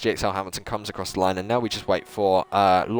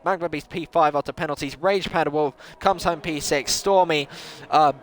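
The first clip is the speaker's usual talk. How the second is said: higher, lively race commentary; live play-by-play; 6 semitones higher than usual. Acted. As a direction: call the lap for the viewers.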